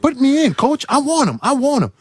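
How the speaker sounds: high, eager begging to get in the game; eager pleading. Retold or imitated. Rookie begging his coach to put him in, high and eager. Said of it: "the whole time I'm going".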